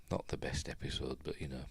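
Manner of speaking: "Not the best episode" in a voiceless whisper; a whispered afterthought; whispered aside. Real, not acted. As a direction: say it again under your breath. Repeats verdict, then whispers it.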